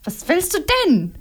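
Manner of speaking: nervous. A narrator's reading. Nino asking Momo what she wants, nervous. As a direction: snap the question out uneasily. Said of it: "erkundigte Nino sich nervös".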